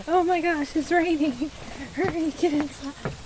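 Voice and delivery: high, soft sing-song voice to the cat; high cooing pet voice; pet talk. Acted. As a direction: coax the cat indoors in a high baby voice. High voice urging cat in rain.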